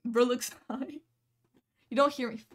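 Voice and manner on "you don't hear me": ordinary talk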